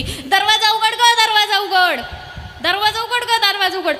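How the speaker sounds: pleading shout at a shut door, half laughing; calling to open the door. Retold or imitated. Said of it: "असं चार पाच वेळेस त्याने आवाज दिला".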